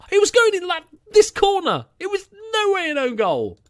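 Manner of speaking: high, loud outraged insistence; indignant protest. Real, not acted. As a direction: protest it wasn't an own goal. Indignant protest over own goal.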